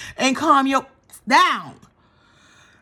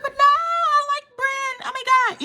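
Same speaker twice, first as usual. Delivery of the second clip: much higher, squealing protest; excited protest. Retold or imitated. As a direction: gush in her own defense. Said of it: "I was like".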